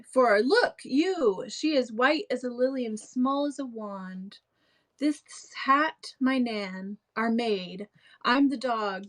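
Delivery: hammed-up clown pleading over his props; acted Shakespeare with big emphasis; theatrical Shakespeare reading. Continues Launce monologue aloud.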